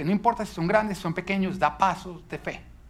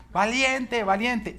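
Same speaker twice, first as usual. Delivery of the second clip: mock battle cry, pitched higher; higher-pitched imitation of his son's cry; imitated brave shout; 6 semitones higher, much slower than usual. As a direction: call it out like a little boy psyching himself up. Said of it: "cómo él grita".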